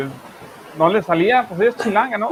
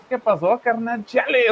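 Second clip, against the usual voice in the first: playful imitation of a Mexico City chilango accent, higher pitch; tossing off slang in a put-on chilango accent; mock chilango accent; 7 semitones higher, slower than usual. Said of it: "no voy a empezar a hablar como del D F".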